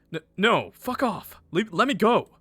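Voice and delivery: high, loud struggling protest; acted panicked demand; frantic resistance. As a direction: fight off attackers with your voice. Victim struggles while held down.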